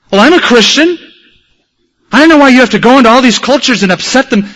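preacher mimics a huffy passenger who scolds him; indignant airplane lady. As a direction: play the offended lady from the plane, a bit pushy. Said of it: "she said".

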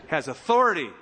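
normal talking voice

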